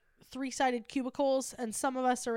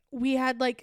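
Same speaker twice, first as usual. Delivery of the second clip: normal talking voice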